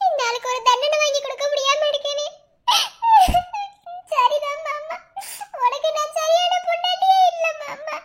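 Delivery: high, slow sobbing plea; tearful lament. Acted. Wife weeping over failing her husband, sobbing. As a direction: cry out your helplessness to your husband.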